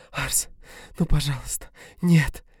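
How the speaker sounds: woman begging him to stop; desperate begging. A narrator's reading. Dina begging.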